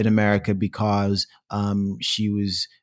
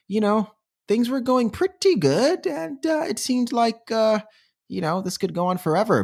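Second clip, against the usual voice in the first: dreamy; 11 semitones higher than usual. Acted. Mock rosy storytelling.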